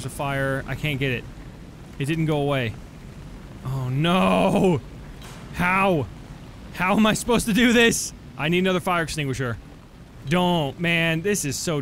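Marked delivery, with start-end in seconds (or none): 3.6-8.1 exasperated wail. Real, not acted. Out of extinguisher, stuck.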